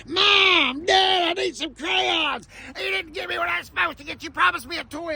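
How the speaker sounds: put-on whine-shout like a pestering child, high; imitating screaming kids. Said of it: "little Rugrats screaming".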